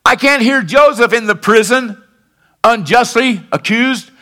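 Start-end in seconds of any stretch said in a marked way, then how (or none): none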